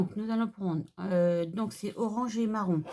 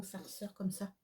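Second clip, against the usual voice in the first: half-whispered aside; whispering; 9 dB quieter than usual. Real, not acted. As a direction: breathe the words out softly. Aparté chuchoté pendant le travail. (chuchoté)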